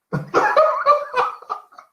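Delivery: a loud, high burst of laughter. Real, not acted. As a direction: crack up at your own line. Laughs after joke.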